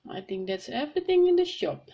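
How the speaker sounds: Miss Rabbit. A narrator's reading. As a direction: lift the voice for another character. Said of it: "said Miss Rabbit".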